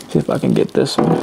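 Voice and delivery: half-whispered to himself; whispered mutter. Real, not acted. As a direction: mutter it under your breath. Muttering to himself while fitting clips.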